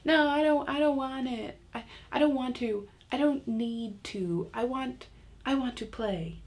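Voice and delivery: high, whiny imitation of a kid; protesting child. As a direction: whine and stall like a small child. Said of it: "it's something like".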